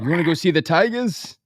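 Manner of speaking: playful retold boxer voice; Rocky. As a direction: lightly imitate the movie boxer asking his date out. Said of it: "He was like".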